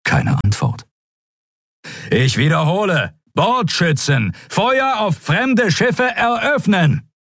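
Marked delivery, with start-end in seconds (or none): 2.1-7.1 slow, high-pitched repeated order; urgent commander demanding to be obeyed; insistent calling out. A narrator's reading. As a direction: hammer out the firing command, drawn out. Admiral repeats unanswered battle order.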